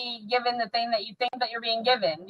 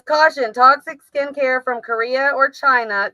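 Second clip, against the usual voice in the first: mock warning label read; 3 semitones higher, 3 dB louder, slower than usual. Shown as a demonstration. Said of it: "It says".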